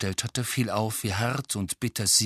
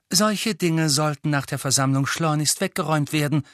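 higher, sharp angry snap; snapping; 6 semitones higher, 4 dB louder than usual. Acted. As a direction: snap at them to tidy up. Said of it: "fauchte sie".

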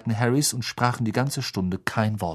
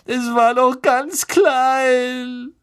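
high, loud and slow, sobbing; 14 semitones higher, 6 dB louder, much slower than usual. Acted. Lavender sobbing about her little rabbit, sobbing. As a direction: wail it through tears. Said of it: "schluchzte Lavender".

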